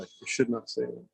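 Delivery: softly under breath; quiet aside. Real, not acted. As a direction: mumble it to yourself. Self-censoring aside.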